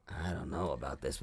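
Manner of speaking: very low, soft mutter; uneasy aside. Retold or imitated. Nervous passenger to himself in the van, low uneasy mutter. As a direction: mumble your doubts to yourself.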